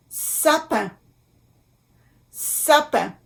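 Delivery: "Sapin" in French, nasal final "pin"; careful French pronunciation. Shown as a demonstration. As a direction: model "Sapin" with a nasal ending. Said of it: "I N".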